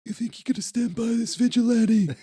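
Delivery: high, wispy, breathy police chief voice. Retold or imitated. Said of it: "He's just got that weird wispy voice".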